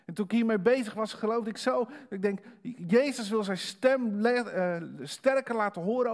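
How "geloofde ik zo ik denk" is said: ordinary talk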